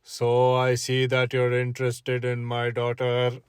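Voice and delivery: a hint of a low, stern father; lightly voiced suspicious dad. Girlfriend's dad questioning the date, low and stern. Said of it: "he looks at me and he says".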